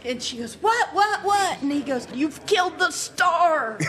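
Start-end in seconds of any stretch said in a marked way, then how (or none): 0.5-1.6 high, loud mock alarm; startled old lady. Startled old lady driver acted out. 2.1-3.8 high, slow theatrical outcry; mock accusation. Mock accusation of the horrified driver.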